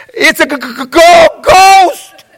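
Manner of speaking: a stuttering scream of ghost; terrified stammer. Retold or imitated. Disciples terrified, see a ghost.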